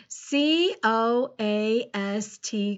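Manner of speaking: slow, letter by letter; measured, separated letters; spelling aloud. Shown as a demonstration. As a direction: spell it out slowly for a class. Teacher spelling a word aloud.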